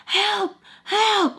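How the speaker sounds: high, panicked cry for help; mock screaming for help. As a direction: yelp it like she's being pinned down. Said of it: "like that".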